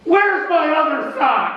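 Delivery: high, slow, loud general's plea; bleary general. Acted. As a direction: whine for the lost sock. Grant searching.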